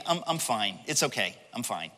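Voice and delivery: low, soft acted brush-off; quiet, complacent shrug of a voice; dismissive reassurance. Voices someone brushing God off.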